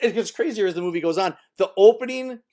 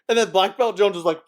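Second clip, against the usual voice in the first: ordinary talk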